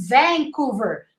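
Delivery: Vancouver with a long A in "van"; Vancouver, full open first vowel; corrected pronunciation. Retold or imitated. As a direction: say Vancouver as VAAN-couver. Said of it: "six A's".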